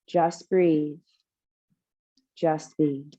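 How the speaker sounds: calm, measured meditation voice with long pauses; guided meditation. Real, not acted. Yoga teacher leading the class into rooting breath, meditative calm. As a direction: guide them gently into stillness, unhurried.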